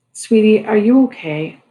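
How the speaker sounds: sweet grandmother. A narrator's reading. Grandmother on the phone to jailed grandchild, lightly sweet. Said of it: "I hear Grammy's sweet little voice".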